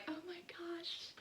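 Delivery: high, whispered oh my gosh; breathless awe. Retold or imitated. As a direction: gasp it in wonder. Emily marvels at berries.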